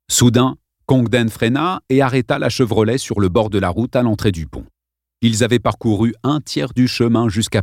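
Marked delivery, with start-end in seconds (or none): none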